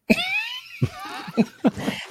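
shrieking high laugh. Real, not acted. Burst of laughter at banter.

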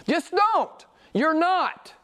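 short, high-pitched preaching rebuke; emphatic rebuke. Real, not acted. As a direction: shut the claim down in high, separate bursts. Blunt climax of a rebuke.

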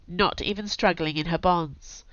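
ordinary delivery

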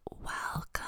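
unvoiced whisper; ASMR host whisper for fans. Shown as a demonstration. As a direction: whisper a welcome ASMR-style. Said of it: "People are like, "Do A S M R".

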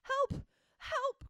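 high, loud panicked cries for help; panicked cry. Retold or imitated. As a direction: cry out in terror like a tiny pig. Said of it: "cried Piglet".

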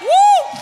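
high, loud celebratory woo; joyful whoop. Real, not acted. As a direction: let out a triumphant whoop. Climax of shouted declaration.

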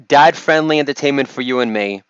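ordinary talk

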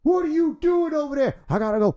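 startled. Pretend. Reporter caught, flees scene.

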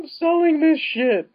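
high-pitched overacted drama; exaggerated, straining dramatic delivery; mock earnest actor. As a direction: ham it up like an actor selling every word. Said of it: "I'm not trying to make fun of the actor".

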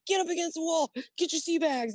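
high mocking command; mock order. Marines ordering recruits.

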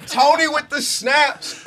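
high, slow hype yell; hype shout. Acted. Hyping up the rant.

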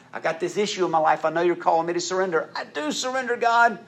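high, loud pleading prayer; loud anguished prayer; raw prayer. Acted. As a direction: cry it out to God.

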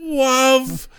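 high, crying, shouting villain; hammy villain wail. Pretend. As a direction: wail true love like a cartoon bad guy. Mock villain on true love.

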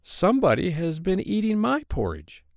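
high-pitched mother bear at moderate volume; middle-sized Mama Bear. A narrator's reading. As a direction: lift it high, without shouting, as the mother bear. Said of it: "said in her middle-sized voice".

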